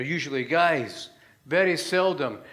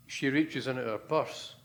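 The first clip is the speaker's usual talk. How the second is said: usual speaking voice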